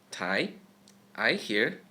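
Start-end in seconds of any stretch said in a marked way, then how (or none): 0.1-0.5 Tie rising like a question, second tone. Shown as a demonstration. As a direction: ask Tie with an upward lift. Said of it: "I here, is the second tone".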